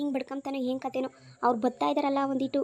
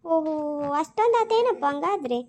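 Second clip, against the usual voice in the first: impressed surprise; 3 dB louder, slower than usual. Acted. Guest hears the house cost.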